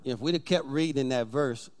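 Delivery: low, quiet altar call. Acted. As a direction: call them gently to their feet. Pastor calls congregation to stand.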